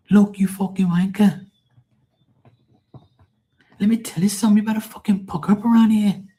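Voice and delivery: an Irish wife chewing out her needy man; angry Irish woman. Pretend. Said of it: "no more Irish, acting like some Irish jackass".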